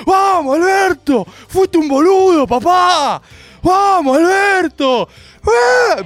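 high, loud hammed-up cheering; arenga a los gritos. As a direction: shout like a fanatical supporter in a crowd. Imita al público que alienta. (hinchada gritando, exagerada)

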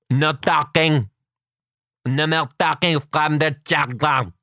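slow, lightly hammed fake French; mock French teacher. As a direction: play a stiff French teacher badly. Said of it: "Don't respond to me in French".

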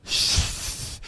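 spitty, lisping shush through the teeth; a wet, lisping shh; lispy shush. Retold or imitated. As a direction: shush him hard with a lisp and spit. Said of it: "that was lispy".

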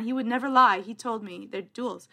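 ordinary talk

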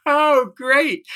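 high mock "oh great" in a put-on voice; a high, put-on voice of dry disappointment; sarcastic thought. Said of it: "I just thought".

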